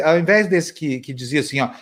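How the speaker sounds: usual speaking voice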